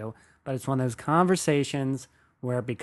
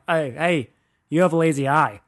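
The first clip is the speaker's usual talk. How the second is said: louder mock busybody callout; mock calling out; 3 semitones higher, 6 dB louder than usual. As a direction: call out to a stranger as a tactless busybody. Acts out pointing at strangers' defects.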